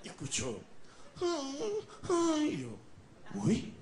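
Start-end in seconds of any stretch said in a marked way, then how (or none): 1.2-2.5 high whimpering cry; high wail. Acted. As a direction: wail like a scared little panda. Crying heard from the panda. (gemido agudo de osito)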